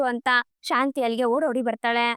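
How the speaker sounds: ordinary delivery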